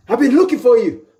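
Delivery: high, pressing challenge; mock confrontation. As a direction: confront someone, pitched well up. Acts out an angry confrontation.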